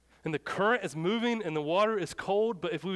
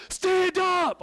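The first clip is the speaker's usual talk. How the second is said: full exasperated shout, much higher and louder; yelling; 13 semitones higher, 7 dB louder than usual. Retold or imitated. Youth pastor to girl screaming in shallow water, yelling. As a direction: shout it at the top of your voice. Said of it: "Yelling. I'm like".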